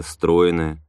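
ordinary delivery

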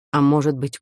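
plain reading voice

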